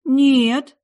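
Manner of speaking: high, loud, bewildered no; baffled girl's high-pitched denial; puzzled girl. Acted. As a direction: deny it in bewilderment. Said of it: "ответила девушка недоумённо".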